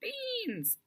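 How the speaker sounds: high shouted Beans with a snicker. Acted. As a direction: shout it through a giggle. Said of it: "Delphi snickers and shouts".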